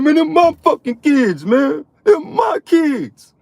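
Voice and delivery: high, squealing mock protest; mock offended man. Imitating an offended camp member defending his kids, high whine. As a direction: whine it high, stretching the final words. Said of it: "he got offended".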